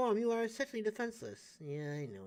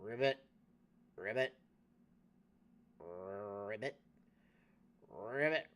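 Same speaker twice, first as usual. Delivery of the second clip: low croaking ribbit, pitched down; toad croak; 9 semitones lower, 6 dB quieter, slower than usual. Pretend. Player turned into a toad, croaking.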